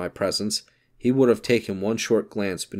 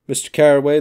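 calling out; 7 semitones higher, 9 dB louder, faster than usual. Acted. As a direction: call the name out loud and high. Gatsby calling out introduction.

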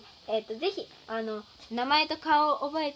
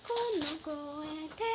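put-on voice; 3 semitones higher, 4 dB quieter, much slower than usual.

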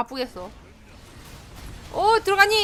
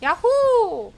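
long drawn-out, higher yahoo; cheer; 7 semitones higher, 6 dB louder, much slower than usual. Real, not acted. Player celebrating in game, slow drawn-out yahoo. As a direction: stretch the cheer out slowly.

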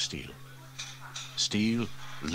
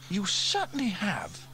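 Sarah voiced much higher, stern and scolding; stern girl; 12 semitones higher than usual. Girl scolds the fire chief for his remark, sternly. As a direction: tell him off like a cross little girl. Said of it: "said Sarah sternly".

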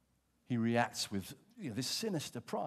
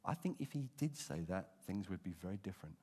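ordinary talk

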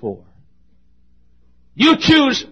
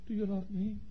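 hushed plea; 3 semitones higher, 18 dB quieter than usual. Acted. God's question, hushed.

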